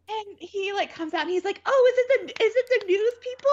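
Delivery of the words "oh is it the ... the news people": high, fast, eager questions; imitating an excited grandfather; super excited. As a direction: bubble over like a kid on Christmas. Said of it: "He's, like, super excited".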